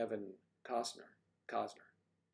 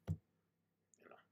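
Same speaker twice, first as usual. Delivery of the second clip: whisper; 10 dB quieter than usual. Real, not acted. Aside after the impression.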